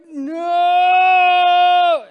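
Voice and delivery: high, very loud scream of no; desperate screamed no. Retold or imitated. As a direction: shriek it as the foot goes down. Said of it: "and I'm like".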